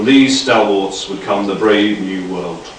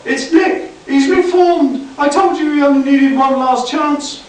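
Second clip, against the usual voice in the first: much higher, thrilled cry; excited Dot. A narrator's reading. Dot to the others about Nick, excited high exclaim. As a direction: gush with delight, high and bright.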